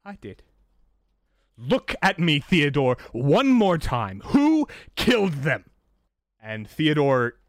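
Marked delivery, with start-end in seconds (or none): 0.0-0.4 soft, high confession; whisper-small "I did"; quiet, barely voiced admission. Theodore repeats confession. 1.6-5.7 loud, slow, commanding interrogation; forceful demand. Acted. Sun demands confession, pressing harder.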